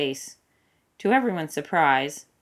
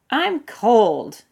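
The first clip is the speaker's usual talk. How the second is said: whiny raised complaint; complaining cold; 7 semitones higher, 8 dB louder than usual. A narrator's reading. Aramis whining at the frozen forest. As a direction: Aramis grumbles loudly to the forest.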